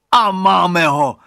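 slow, high, very loud cry; triumphant shout. A narrator's reading. Boy seeing the bully trapped, triumphant shout. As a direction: crow at full volume. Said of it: "vykřikl Radovan".

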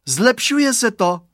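high, loud, slow cheer; jubilant. A narrator's reading. Girl cheering as the fog clears, jubilant. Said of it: "zajásala Kateřinka".

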